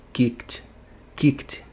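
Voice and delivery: "Kicked" as kikt, one syllable. Shown as a demonstration. Teacher modelling the third case, "Kicked" ending in T. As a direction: end "Kicked" on a voiceless T straight after the K. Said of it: "coloca um T".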